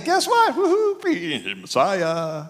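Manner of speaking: high, gleeful mock whoop with a laugh; mock cheer. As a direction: play a giddy neighbour cheering. Mock townsfolk cheering the Messiah.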